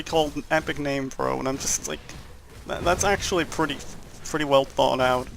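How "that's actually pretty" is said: ordinary delivery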